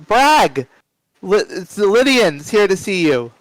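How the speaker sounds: calling out. Acted. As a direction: call through to the next room. Secretary calls out to the boss.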